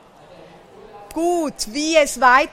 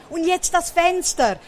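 slightly raised, eager call; mild, higher-edged character call; 7 semitones higher, 3 dB louder than usual. A narrator's reading. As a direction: call it out lightly in storybook style. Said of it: "ruft Berti".

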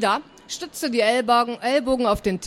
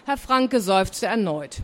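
plain reading voice

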